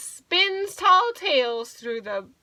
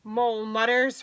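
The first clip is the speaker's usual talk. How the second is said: ordinary delivery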